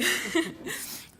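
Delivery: embarrassed little laugh; nervous laugh. Real, not acted. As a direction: laugh off the slip. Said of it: "I'm a little nervous".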